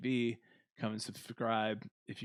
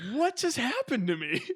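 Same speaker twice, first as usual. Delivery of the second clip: laughing disbelief; 13 semitones higher, 7 dB louder than usual. Retold or imitated. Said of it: "And I'm like".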